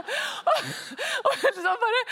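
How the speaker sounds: high, loud words broken by laughter; laughing through words. Real, not acted. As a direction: keep laughing as you speak. Laughing while telling story. (ler mens hun forteller)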